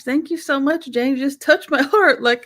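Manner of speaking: high, voice on the edge of crying; fighting back tears, real; holding back tears. Real, not acted. Host moved by guest's words, fighting back tears. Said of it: "I'm trying not to cry over here".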